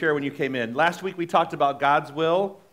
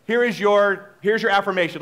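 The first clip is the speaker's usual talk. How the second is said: ordinary delivery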